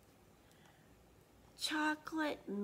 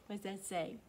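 put-on lively read-aloud for kids; animated storybook narration; story reading; 8 dB louder than usual. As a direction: read it like a picture book to children. Reads a picture book aloud.

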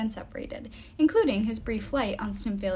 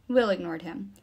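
usual speaking voice

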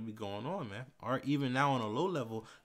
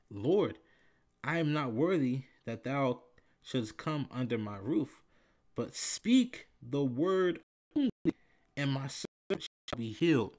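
slow, louder plea with a lift on "but speak the word only"; scripture reading, pleading; 4 dB louder than usual. Acted. Preacher voicing the centurion's humble plea from scripture. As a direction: plead humbly through the verse.

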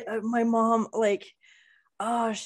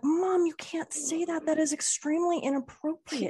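mock scolding daughter; 9 semitones higher than usual. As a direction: raise the pitch and drag it out like a scandalized kid. Kid scolding mom in retold scene.

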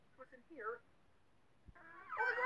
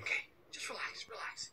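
whispering; 10 semitones lower, 5 dB quieter than usual. Real, not acted. Calming himself after a scare.

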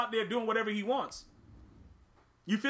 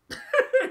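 short high burst of laughter; a sudden squeaky laugh; 6 dB louder than usual. Real, not acted. Amused by the game.

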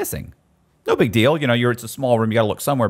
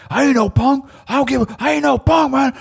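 high, louder imitation of the cellmate; mock hot-headed inmate; 6 dB louder than usual. Cellmate insisting he isn't a punk, high and loud. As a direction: bark it high like a riled giant. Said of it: "And he's like".

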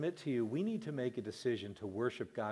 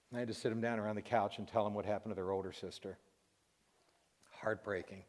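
ordinary delivery

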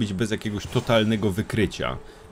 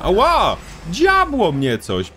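mock pain yelp; 6 semitones higher, 4 dB louder, much slower than usual. Real, not acted. Player character hit by arrow.

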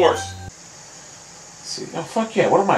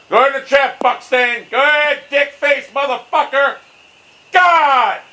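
high-pitched angry rant; furious cursing; 10 semitones higher than usual. Real, not acted. Gamer cursing the ball into a trap, furious. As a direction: goad the ball, exploding on the last word.

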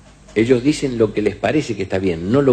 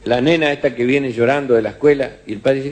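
normal talking voice

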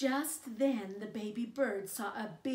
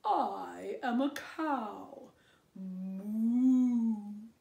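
slow, louder lowing cow voice; 8 dB louder, much slower than usual. Acted. Cow answering the baby bird, slow louder cow voice. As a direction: drag it out like a cow. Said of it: "said the cow".

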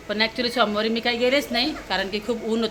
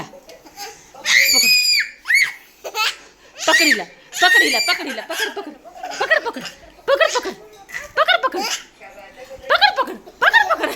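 egging on the chase, pitch rising each time; excited shouting; 12 semitones higher than usual. Real, not acted. Mother urging the catch, excited shouts rising high.

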